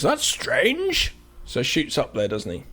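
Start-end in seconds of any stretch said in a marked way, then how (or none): 0.0-1.1 high, wondering that's strange; puzzled doctor. Retold or imitated. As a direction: rise up in puzzled surprise.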